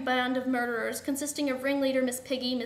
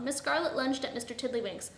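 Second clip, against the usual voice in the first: plain reading voice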